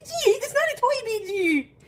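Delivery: high playful voice for a cat; squeaky baby talk to the cat; fun pet voice. Acted. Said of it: "doing the fun voice for her".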